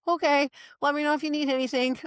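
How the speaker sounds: high, sing-song brush-off; breezy unbothered. Retold or imitated. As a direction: chirp it, totally unbothered. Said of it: "And I'm just like".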